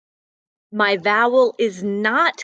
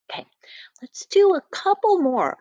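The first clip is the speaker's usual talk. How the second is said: whisper; 10 semitones higher than usual. Real, not acted. Teacher whispering aside.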